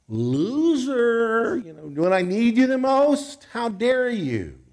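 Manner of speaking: the Lord's imagined outraged rebuke, hammed up; shocked rebuke. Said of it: "was it the look of shock".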